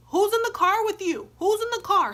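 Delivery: high, loud insistent demand; loud challenge. Retold or imitated. Demanding who stayed in the car, high loud challenge. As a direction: re-enact shouting the question at him. Acted challenge to baby daddy.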